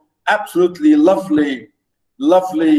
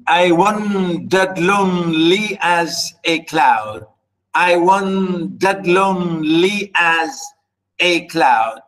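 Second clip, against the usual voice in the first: "I WAN-dered LONE-ly as a CLOUD" slowly, beat by beat; slow unstressed-STRESSED scansion of "I wandered lonely as a cloud"; exaggerated iambic meter; slower than usual. Shown as a demonstration. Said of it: "unstressed stress, unstressed stress".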